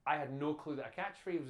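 ordinary delivery

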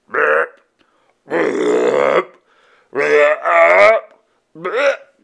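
loud mock burps; silly burping noises. A narrator's reading. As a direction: burp as loud as you can. Monsters burping contest.

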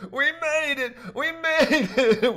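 high, loud joyful scream, second cry slow with a laugh. A narrator's reading. Rafter on his knees in the water, screaming for joy. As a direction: shriek it out in relief.

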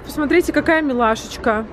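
ordinary talk, nothing marked

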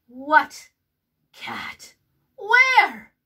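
sudden loud jumpy alarm; alarmed question. A narrator's reading. Pepito fearing the cat, loud and jumpy. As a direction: startle and look around. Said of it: "asked Pepito".

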